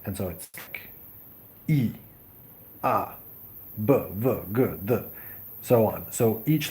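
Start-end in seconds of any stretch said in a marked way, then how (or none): 1.7-5.0 E as "ee", A as "ah", B, V, G, D as bare consonant sounds; letter sounds. Shown as a demonstration. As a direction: sound out E, A, B, V, G, D singly, with pauses, not as English letter names. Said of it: "one letter is one sound".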